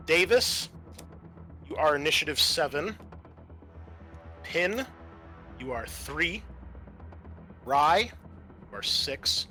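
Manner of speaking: ordinary talk, nothing marked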